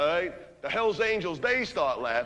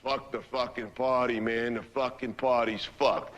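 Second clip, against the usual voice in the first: deadpan Frankie; 6 semitones lower, slower than usual. Retold or imitated. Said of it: "he goes".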